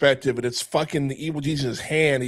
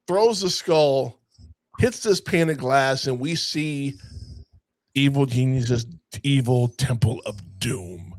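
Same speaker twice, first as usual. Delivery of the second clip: normal talking voice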